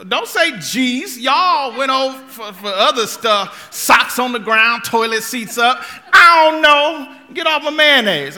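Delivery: loud, high ranting list of complaints; hangry rant. Retold or imitated. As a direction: vent like a hangry husband. Re-enacted rant at wife.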